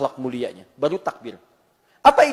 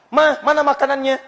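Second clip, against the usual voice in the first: demanding husband; 10 semitones higher, 7 dB louder than usual. Acted. Husband asks wife for food.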